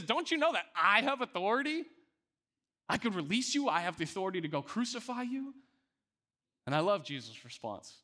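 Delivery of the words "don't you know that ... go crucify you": much higher, urgent and coaxing; pressing Pilate. Retold or imitated. Pilate urging Jesus to recant, high and coaxing. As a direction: Pilate leaning on his power, pitched way up.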